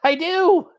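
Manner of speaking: a playful high-pitched yes, I do; sassy yes. Retold or imitated. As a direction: chirp it back with cheeky pride. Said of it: "I'm like".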